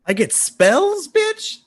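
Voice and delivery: high, drawn-out thrilled shriek; excited squeal. Real, not acted. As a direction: squeal it out with delight. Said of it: "Sorry, I got excited".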